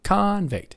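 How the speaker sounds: Convict with CON pitched high; first-syllable stress. Shown as a demonstration. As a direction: lift CON high in Convict, then drop. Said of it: "goes up higher, a little louder on the first syllable".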